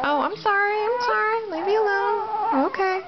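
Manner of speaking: high, put-on baby voice, whiny; voicing the baby. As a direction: speak for the baby, pleading to be left alone. Mom voices the fussy baby.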